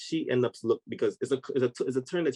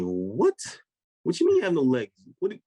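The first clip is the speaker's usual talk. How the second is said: imitating a baffled man, higher-pitched; rising "what?", then high, louder spluttering; confused disbelief; 5 semitones higher, 3 dB louder than usual. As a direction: act out total bewilderment. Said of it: "He was so confused".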